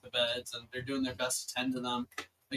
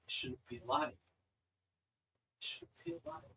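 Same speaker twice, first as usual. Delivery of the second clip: soft, trembling traumatized voice; hushed survivor reliving the horror; broken, near tears; 2 semitones higher, 4 dB quieter than usual. Acted. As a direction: tell it like she can barely hold back tears. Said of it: "like she's trying to cry".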